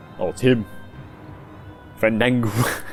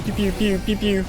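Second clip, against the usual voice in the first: laser pew noises; 9 semitones higher than usual. Pretend. Mimics laser shots while playing.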